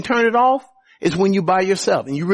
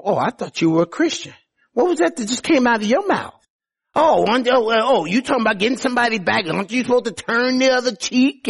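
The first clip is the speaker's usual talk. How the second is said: mock accusing relative; 6 semitones higher than usual. Mocking family member accusing believer.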